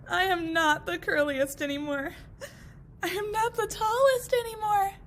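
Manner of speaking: high, loud tearful wail; crying lament. Acted. Cut grasses mourning, high tearful wail.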